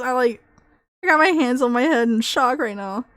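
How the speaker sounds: normal talking voice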